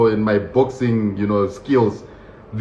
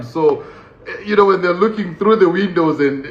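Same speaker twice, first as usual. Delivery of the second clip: usual speaking voice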